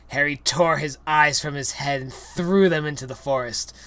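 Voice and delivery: ordinary delivery, nothing marked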